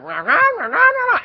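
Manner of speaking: loud, high wordless scolding; Charlie Brown grown-up squawk. Retold or imitated. Grandma scolds the boy, mock cartoon squawking. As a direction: imitate a nagging adult as loud gibberish. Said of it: "Charlie Brown".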